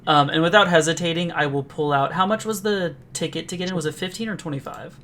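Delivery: normal talking voice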